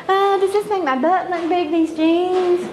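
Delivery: high, breathy mock shopper voice asking about jeans; imitating fussy shopper. Comic retelling of women at T J Maxx, high mocking voice. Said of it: "I hear women saying".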